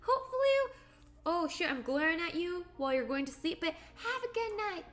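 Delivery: sweet thanks. Real, not acted. High sweet thanks to viewer.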